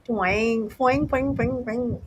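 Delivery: high, twanging mock bionic sound; twanging sound effect. Mimicking the TV sound effect as a high twang. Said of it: "That's the sound".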